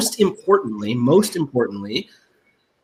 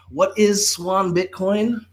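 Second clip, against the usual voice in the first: slow dramatic question; 5 semitones higher, slower than usual. Acted.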